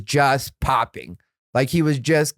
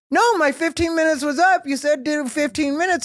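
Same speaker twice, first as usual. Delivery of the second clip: indignant protest; 12 semitones higher than usual. Retold or imitated. Defending himself to booker.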